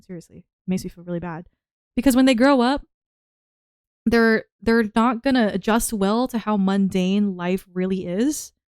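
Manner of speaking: ordinary delivery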